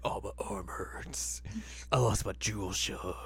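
hammed-up sulky muttering; mock angsty whisper. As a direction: whisper like a dramatic teenager. Voicing angsty Koga.

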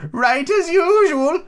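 put-on very high, slow, prim old lady; Duchess voice. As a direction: hold the Duchess's high sing-song. Duchess voiced in read story.

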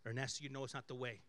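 quietly steady the angry man; a hushed low calm voice of God; voice of the Holy Spirit. Retold or imitated. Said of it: "the Holy Spirit saying".

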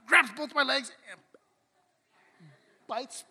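usual speaking voice

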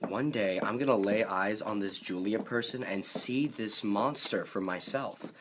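ordinary delivery

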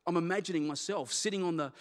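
normal talking voice

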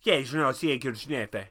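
put-on unintelligible mumbled Irish Traveller accent; Brad Pitt in Snatch. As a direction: run words together in a thick Irish imitation. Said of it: "fully commits to being unintelligible".

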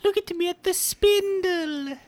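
high, fast squeaky disc voice; talking DVD voice. Acted. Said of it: "I'm a laser disc".

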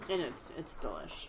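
talking while eating pizza; words round a bite of pizza; mouth full. Real, not acted. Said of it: "Sorry, I'm eating some pizza".

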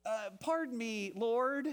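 a hint of a hesitant, pleading Gideon; lightly voiced nervous man asking to be excused; reluctant Gideon. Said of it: "Can you hear his voice?".

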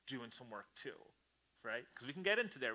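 ordinary talk

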